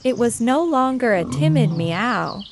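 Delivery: ordinary delivery, nothing marked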